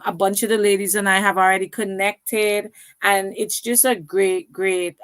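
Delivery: ordinary talk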